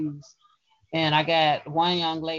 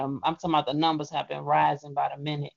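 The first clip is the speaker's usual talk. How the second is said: ordinary talk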